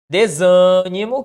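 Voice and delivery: "Desânimo" slow and loud, long held "sâ"; stretched tonic syllable. Shown as a demonstration. Teacher quizzing the stress rule of "Desânimo", drawn-out. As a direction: hold out the "sâ" of "Desânimo". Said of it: "Que regra é essa?".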